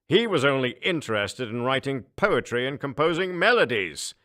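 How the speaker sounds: mock the poet king with contempt; disdainful emperor. Emperor mocks.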